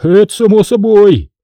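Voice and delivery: high, loud, cocky answer; smirking reply. A narrator's reading. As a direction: toss it off with a smirk. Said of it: "Ответил с ухмылкой".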